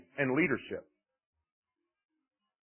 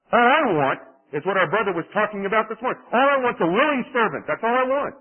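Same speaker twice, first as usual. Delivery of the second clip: loud, higher, ringing declaration of God; God's forceful call; 5 semitones higher, 6 dB louder than usual. Acted. Preacher voicing God loudly to Moses. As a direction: proclaim it as God's own demand.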